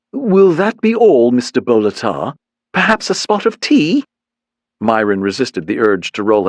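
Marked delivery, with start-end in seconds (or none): 0.1-4.0 mock British butler voice, pitched up; hammed-up British accent offering tea; fake British accent. A narrator's reading. Receptionist mocking the agents in fake British accent. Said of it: "put on a fake British accent".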